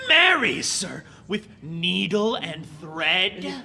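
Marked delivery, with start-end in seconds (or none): 0.1-0.6 higher and louder exclamation; a loud, high burst of protest; sudden outburst. Acted. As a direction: blurt it out sharply. Servant bursts out in retort.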